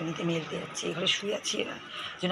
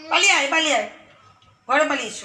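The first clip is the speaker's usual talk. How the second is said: higher, louder playful call; excited calling; 6 semitones higher, 10 dB louder, faster than usual. Real, not acted. Mother calling her toddler to run home, loud playful call.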